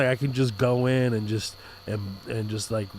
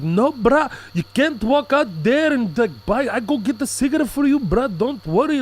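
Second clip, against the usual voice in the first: put-on high, insistent accented voice; mock South African guard, pitched up; South African security guard; 10 semitones higher than usual. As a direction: stop a guest from going out. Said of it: "the security guy was like".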